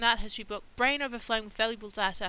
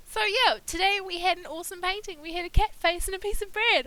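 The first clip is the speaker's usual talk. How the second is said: higher, louder, gushing delight; excited recap; 6 semitones higher, 6 dB louder than usual. Real, not acted. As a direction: gush over how cool it was. Upbeat recap of the day's painting.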